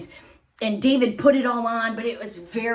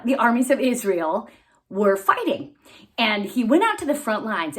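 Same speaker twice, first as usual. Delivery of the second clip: ordinary delivery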